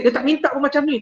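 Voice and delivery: normal talking voice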